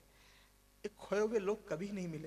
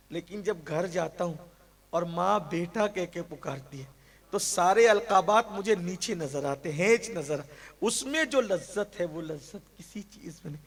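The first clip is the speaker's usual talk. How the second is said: louder impassioned sermon; full-force preaching; 11 dB louder than usual. Acted. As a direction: ring it out to the hall. Fiery preaching.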